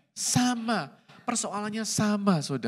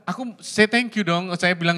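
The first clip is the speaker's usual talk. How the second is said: ordinary talk, nothing marked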